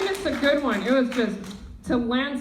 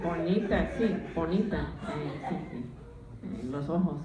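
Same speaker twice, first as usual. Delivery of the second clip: put-on low, soft old Spanish grandma voice; grandma impression; 6 semitones lower, 4 dB quieter than usual. Comedian imitating her grandma cooing at her in Spanish, low and soft. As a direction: croon at the granddaughter like an old abuela. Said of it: "She's like".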